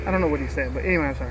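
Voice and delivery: normal talking voice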